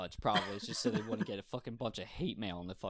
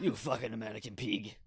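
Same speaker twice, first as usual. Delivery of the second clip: mock French insult; bad fake French accent; hammed-up French accent. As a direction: sneer it like a cartoon Frenchman. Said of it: "really bad French accent".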